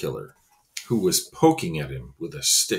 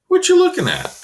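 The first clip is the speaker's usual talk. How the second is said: put-on kid voice; 8 dB louder, faster than usual. Specimen's voice, high.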